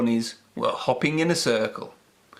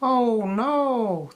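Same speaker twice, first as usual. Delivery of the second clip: higher mewing kitten voice; 9 semitones higher than usual. A narrator's reading. Kittens turn Peppy away, mewing higher. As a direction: mew it like a kitten. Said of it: "they mewed".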